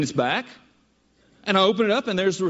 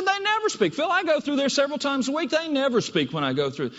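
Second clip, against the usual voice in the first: much higher put-on wife voice, insisting; mock wife voice; 7 semitones higher than usual. Wife insisting to husband, high mock voice. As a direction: squeak it up as the wife who knows better.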